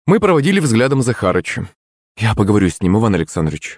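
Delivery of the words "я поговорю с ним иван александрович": ordinary delivery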